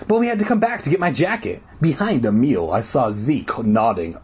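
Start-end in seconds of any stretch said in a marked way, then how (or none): 0.1-1.6 high, rushed excuse; blurting out. A narrator's reading. Girl explains herself to the janitor, blurting quickly. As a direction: rush the lie out in one go. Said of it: "I blurted out".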